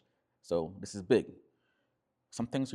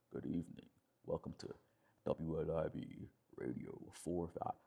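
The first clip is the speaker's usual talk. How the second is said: late night DJ voice; 5 semitones lower, 7 dB quieter than usual. Shown as a demonstration. DJ welcoming late night radio listeners, low DJ voice. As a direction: drop into a smooth FM night-show voice. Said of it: "it's a very low, late night D J voice".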